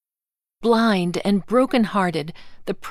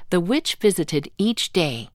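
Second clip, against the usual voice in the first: usual speaking voice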